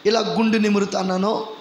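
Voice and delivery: normal talking voice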